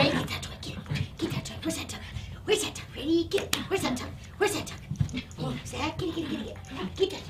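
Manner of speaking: hushed, urgent coaxing; half-whispered excitement for a dog; soft, quick repeated prompts; egging the dog on. Real, not acted. Egging dog on to grab toy.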